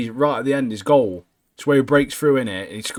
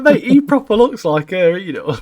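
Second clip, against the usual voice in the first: laughing exclaim; 7 semitones higher, 5 dB louder than usual. Retold or imitated. His own reaction, laughing.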